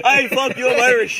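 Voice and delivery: loud, defiant mock yell; mock Irish outburst. Teasing mates about being Irish, loud mock yell. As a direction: bark it out like you've been woken up. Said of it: "you gotta wake up and go".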